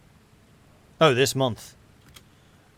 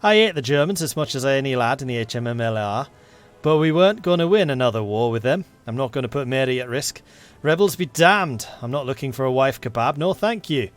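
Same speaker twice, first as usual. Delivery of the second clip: earnest attempt at a Yorkshire accent; animated Yorkshire military policeman. MP explaining he joined for his wife, Yorkshire accent. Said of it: "Is that my best Yorkshire accent?".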